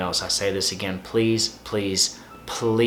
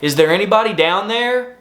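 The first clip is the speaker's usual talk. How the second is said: high, louder call down into a pit; 10 semitones higher, 4 dB louder than usual. Retold or imitated. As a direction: shout down to someone stuck far below. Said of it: "you hear somebody say".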